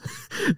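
a short high laugh. Real, not acted. Reviewer laughing at silly dialogue, high giggle.

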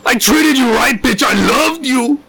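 high, strained yelling, cracking toward tears; mock screaming neighbor. Boyfriend yelling through the window at his ex, high and tearful. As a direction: play the dumped boyfriend howling at the window. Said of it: "Just absolute screaming".

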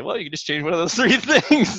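breezy and offhand, breaking into a laugh midway; mock flippant. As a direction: toss the advice off lightly, laughing at how glib it sounds. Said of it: "it sounds almost kind of flippant to say".

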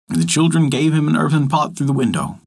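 usual speaking voice

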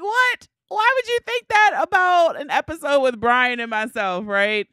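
high, loud disbelieving squeal; playfully scandalized what; mock outrage. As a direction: act shocked and offended. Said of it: "And I'm like".

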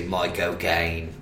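slow, low Cockney accent on "My cocaine"; Michael Caine. Shown as a demonstration. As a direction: draw out "My cocaine" low, demonstrating a British accent. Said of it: "in a Michael Caine accent".

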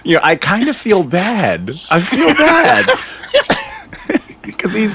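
laughing high through the words; breaking into high laughter. Real, not acted. Host cracks up.

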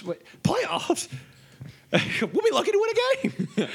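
high, laughing disbelief; laughing scoff. Acted. Scoffing at playoff hopes.

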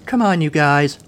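high-pitched call to colleagues. A narrator's reading. New guard to her colleagues, calling out. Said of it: "called out Wendy".

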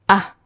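ah very short and light; "ah" brief, unstressed and gentle; neutral tone. Shown as a demonstration. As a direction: tap "ah" gently and let go. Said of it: "pronounced very lightly and gently".

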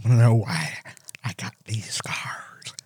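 slow whispered menace; whispered Joker quote; whispered movie Joker. Acted. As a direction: breathe it out like the film villain.

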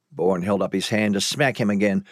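ordinary delivery

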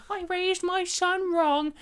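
high, slow wailing mother; hammed-up lament in a high voice; mock distraught mum.

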